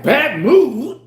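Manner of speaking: loud, high incredulous echo. Retold or imitated. Repeating her words back in loud disbelief. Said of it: "I'm like".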